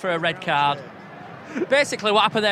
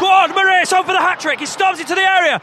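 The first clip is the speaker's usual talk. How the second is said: high, loud match excitement; excited commentary; 11 semitones higher, 7 dB louder than usual. Real, not acted. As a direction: call the attack like a thrilled fan. Excited live commentary on attack.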